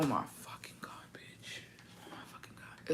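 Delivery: breathless whispered rapture over a cold Coke; hushed, blissed-out whisper; ecstatic whisper. Real, not acted. Savoring Mexican Coke ecstatically.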